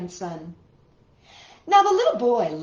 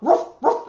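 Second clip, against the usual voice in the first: louder, higher ruff ruff; a playful puppy bark; dog barking; 5 semitones higher, 6 dB louder than usual. Acted. Storyteller barks for the dog to the kids. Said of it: "the dog barked".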